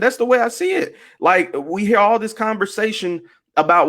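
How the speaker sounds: usual speaking voice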